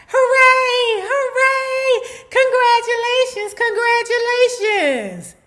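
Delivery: very high, loud sing-song cheers; hammed-up celebrating staff; mock cheering crowd.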